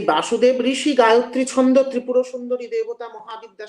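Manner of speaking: loud, ringing ritual recitation; mantra recitation. Shown as a demonstration. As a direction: intone the invocation loud and full. Chanting mantra invocation loudly.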